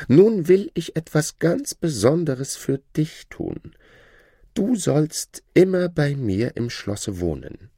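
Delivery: high, lordly and condescending; haughty shadow. Acted. The Shadow lording it over the learned man, haughty high voice. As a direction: talk down to your old master.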